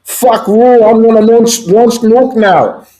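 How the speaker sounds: high-pitched caricature tyrant throwing a tantrum; mock threat, drawn out at the end; hammed-up angry dictator voice; Kim Jong-un. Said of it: "He's like, goes on Twitter".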